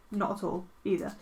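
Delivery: usual speaking voice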